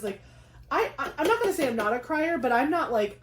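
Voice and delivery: usual speaking voice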